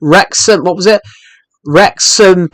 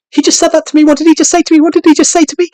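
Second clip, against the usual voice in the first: much higher, mock-offended robot voice; offended Nightbot; 13 semitones higher, faster than usual. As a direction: play the bot taking it personally. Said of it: "He's not gonna turn around and go".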